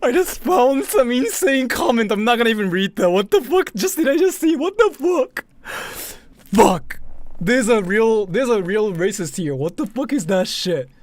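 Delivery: loud, high, stunned swearing; shocked outburst. Real, not acted. Streamer reacting to an awful chat comment, shocked yelling. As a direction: yell in real disbelief at the screen.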